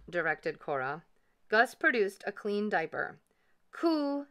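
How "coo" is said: high little coo; contented baby coo. A narrator's reading. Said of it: "said baby ghost contentedly".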